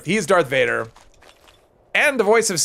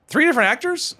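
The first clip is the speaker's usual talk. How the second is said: surprised question; 10 semitones higher than usual. Real, not acted. Surprised repeat of chat line.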